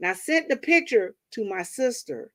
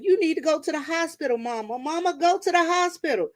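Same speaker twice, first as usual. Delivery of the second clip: higher fussing big sister; sister scolding; 9 semitones higher than usual. Retold or imitated. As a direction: scold mom into the hospital. Older sister scolding mother.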